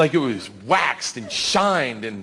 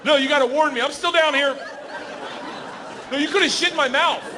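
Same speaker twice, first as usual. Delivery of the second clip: hammed-up high outrage; mock outrage; 10 semitones higher than usual. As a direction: protest it all in shrill disbelief. Indignant rant at wife.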